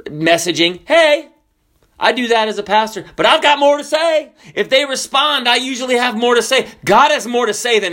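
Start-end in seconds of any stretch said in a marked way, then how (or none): 0.9-1.3 high, loud, empty hail; casual greeting, acted. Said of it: "said something like that to someone". 3.2-7.9 high, driving pulpit voice; emphatic preaching. Real, not acted.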